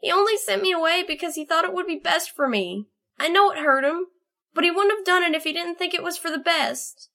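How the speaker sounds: put-on high, hurt young voice defending someone; wounded, high-pitched protest; indignant. A narrator's reading. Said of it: "Snowbolt was indignant".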